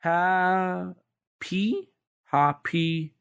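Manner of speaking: tentative Japanese katakana syllables; katakana pronunciation. Shown as a demonstration. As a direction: try it in drawn-out Japanese syllables, questioning. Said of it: "just pronouncing it with katakana pronunciations".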